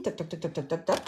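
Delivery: quick rhythmic toc-toc of a machine; light, low run of rapid tocs; imitated cranking noise. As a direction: mimic a hand crank ticking round. Imitates cranking the die-cutting machine.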